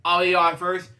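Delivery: ordinary delivery, nothing marked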